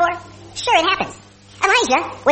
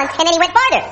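usual speaking voice

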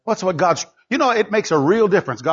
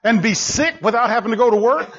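high-pitched, driving preacher delivery; leidenschaftliche Predigt; 10 semitones higher than usual. Real, not acted. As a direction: challenge the congregation with rising urgency. Preaching at full force.

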